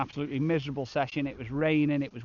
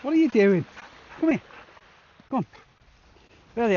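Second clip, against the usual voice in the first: calling to dog; 8 semitones higher, 5 dB louder, faster than usual. Real, not acted. Dog interrupts the video.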